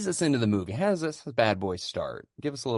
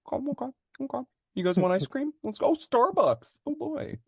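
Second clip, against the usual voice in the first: high cheerful dad voice. Retold or imitated. Family voiced cheerfully clueless.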